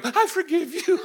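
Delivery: high, wailing imitation of crying; mock tearful forgiveness. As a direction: blubber it in a high voice. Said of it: "she starts crying".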